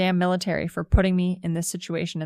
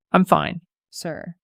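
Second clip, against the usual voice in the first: deadpan; 8 dB louder, much slower than usual. A narrator's reading.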